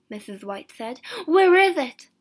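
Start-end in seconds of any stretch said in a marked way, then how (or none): 1.3-2.1 high, loud agitated question; impatient, raised voice; urgent demand. A narrator's reading. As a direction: demand to know at once. Wife demands the paw.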